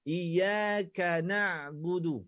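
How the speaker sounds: iyaka na'budu very slow with airy ka; breathy ka. Shown as a demonstration. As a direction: let a little air through the ka in iyaka. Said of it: "ada angin ... sikit je". (iyaka dengan angin sikit)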